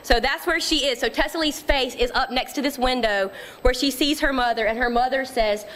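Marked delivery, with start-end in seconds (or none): none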